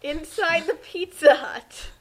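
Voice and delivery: ordinary talk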